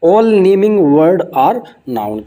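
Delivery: very slow, word-by-word definition reading; dictation pace. Shown as a demonstration. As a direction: spell it out slowly for students to copy. Reads definition slowly. (धीरे-धीरे लिखवाने वाले अंदाज़ में)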